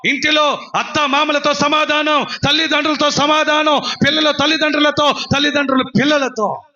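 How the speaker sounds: high, ringing preacher's cry; full-force preaching. Acted. Preacher listing who in the home needs peace, full-force preaching. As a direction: drive each phrase out high and sustained.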